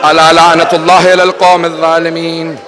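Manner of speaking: low, measured closing chant; Arabic recitation. Acted. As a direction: intone the verse solemnly, drawing out the vowels.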